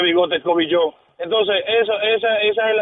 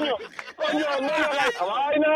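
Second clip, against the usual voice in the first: laughing plea; 5 semitones higher, 7 dB quieter than usual. Retold or imitated. Retold embarrassed plea, laughing.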